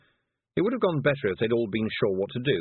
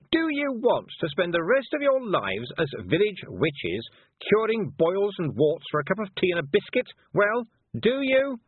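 put-on high, hectoring head-girl voice; imperious, high-pitched scolding; bossy young witch, exasperated; 12 semitones higher, 4 dB louder than usual. A narrator's reading. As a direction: order the group about, higher and impatient.

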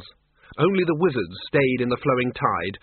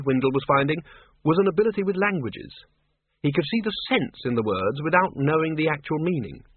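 ordinary delivery, nothing marked

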